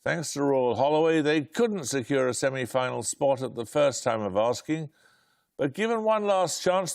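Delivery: ordinary delivery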